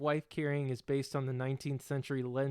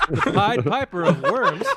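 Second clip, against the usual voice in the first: louder laughing quip; 4 semitones higher, 11 dB louder than usual. Real, not acted. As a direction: toss the joke out through laughter. Joking aside while laughing.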